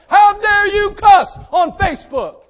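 slow, high shouted rebuke; full-force preaching. Real, not acted. Preacher rebuking members who cuss on Facebook, full-force shouted preaching. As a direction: thunder it at the congregation, drawn out and high. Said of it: "now I'm preaching".

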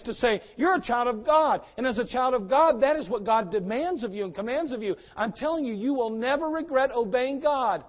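loud, high, driving sermon voice; full-force preaching. Acted. Pastor urging the congregation about obeying God, loud preaching.

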